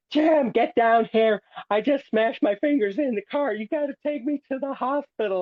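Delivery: high-pitched screaming. Retold or imitated. Brother yelling up the stairs for a ride to the hospital, high-pitched screaming. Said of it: "his voice turned in from this high-pitched screaming".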